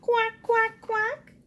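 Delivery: high little quacks; ducklings' quacks. Acted. Ducklings answering their mother with quacks. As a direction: chirp the quacks like tiny ducklings. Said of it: "say the ducklings".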